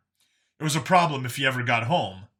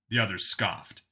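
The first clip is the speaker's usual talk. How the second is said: plain reading voice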